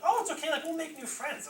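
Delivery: high, loud, chirpy small-child imitation; chipper little sister. Little sister consoling her family about moving, mock chipper. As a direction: imitate a toddler piping up cheerfully, very high. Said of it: "she was really chipper".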